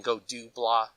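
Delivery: ordinary delivery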